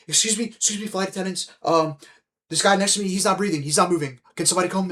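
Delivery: high-pitched panicked plea for help; panicked girl. Retold or imitated. Girl beside the passed-out man calls the flight attendants, high and panicked.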